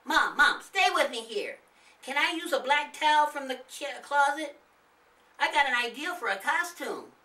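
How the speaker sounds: put-on high, loud tugging kid voice; impatient little boy.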